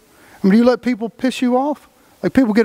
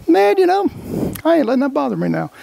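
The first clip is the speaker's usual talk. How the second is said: higher, quick shrug-it-off voice; imitated self-reassurance; 6 semitones higher than usual. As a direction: play a man talking himself out of a bad mood. Imitates Ricky having calmed himself down.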